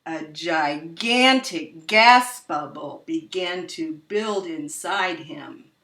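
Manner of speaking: plain reading voice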